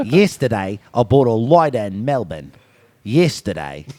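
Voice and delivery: showing off his practiced Australian sentence; Australian accent. Shown as a demonstration. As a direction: say lighter as loidah in a low Australian accent. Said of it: "a sentence, that I could do perfectly".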